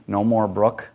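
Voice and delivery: usual speaking voice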